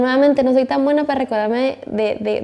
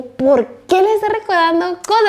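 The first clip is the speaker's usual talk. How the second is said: raised-pitch exasperated question, a touch hammed; indignant retort; 7 semitones higher than usual. Girlfriend retelling her annoyed reply to boyfriend, raised indignant pitch. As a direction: snap back in mock disbelief. Annoyed at being asked to remember.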